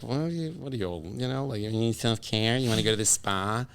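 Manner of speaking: quiet, scoffing jab at a man wanting self-care; mocking. A man mocks a friend for needing self-care, quietly scoffing. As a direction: sneer it under your breath, like a buddy teasing.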